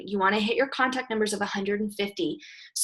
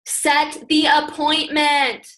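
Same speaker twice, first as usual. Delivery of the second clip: slow, deliberate and a touch louder; slow emphatic instruction; 5 semitones higher, 3 dB louder, much slower than usual. Shown as a demonstration. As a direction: spell the step out slowly. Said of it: "teacher stresses key step".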